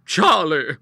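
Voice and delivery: hearty shouted summons. A narrator's reading. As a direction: call out sharply to the next room. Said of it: "she shouted".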